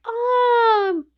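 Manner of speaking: put-on high little girl's startled oh; Leslie's gasp. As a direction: squeal it like a hurt schoolgirl. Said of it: "So Leslie is like".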